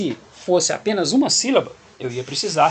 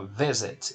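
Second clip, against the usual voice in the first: "visit" stressed on VIS, final syllable unstressed; stress on first syllable of visit; 5 semitones lower, 5 dB quieter than usual. Shown as a demonstration. Teacher shows the final isn't tonic, "visit" stressed on VIS. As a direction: put the weight on VIS in "visit". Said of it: "Não é esse final aqui".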